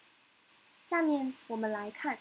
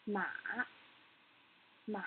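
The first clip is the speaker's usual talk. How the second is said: "Ma" dipping down then back up; 3 semitones lower, 7 dB quieter than usual. Shown as a demonstration. Teacher demonstrates the third tone, "Ma" falling then rising. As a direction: let "Ma" sink and then lift. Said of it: "Let's do it again".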